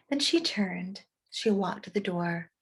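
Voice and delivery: usual speaking voice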